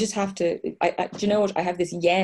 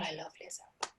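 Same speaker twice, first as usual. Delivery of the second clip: whispered adoration; 16 dB quieter than usual. Real, not acted. Gushing about [name].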